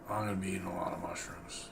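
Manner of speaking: hushed whisper aside. Real, not acted. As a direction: whisper it to the mic.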